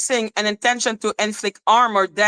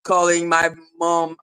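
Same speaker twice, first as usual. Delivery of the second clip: lower, scornful echo of the complaint; mocking low repeat; 6 semitones lower than usual. Real, not acted. Scoffing at the troll for calling her mom, low and mocking.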